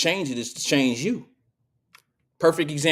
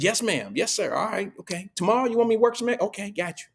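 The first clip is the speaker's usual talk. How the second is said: mock eager employee; 6 semitones higher than usual. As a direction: play the eager worker who agrees to everything. Mocks fawning at work.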